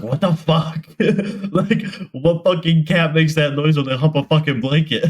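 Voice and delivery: retold bafflement breaking into laughter; incredulous outburst. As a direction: demand an explanation, half laughing. Retelling disbelief at friend's cat excuse.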